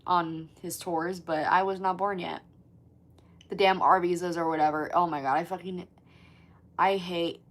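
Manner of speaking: ordinary talk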